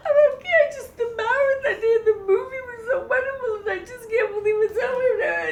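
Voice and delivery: loud, high mock blubbering; squealed, tearful imitation of falling apart; hysterical crying. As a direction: gush it through hammed-up sobs. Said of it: "I am hysterical crying".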